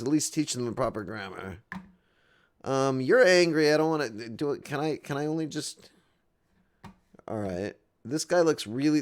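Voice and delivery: ordinary talk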